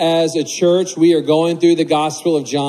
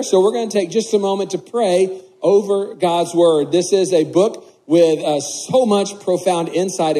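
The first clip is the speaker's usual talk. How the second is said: ordinary delivery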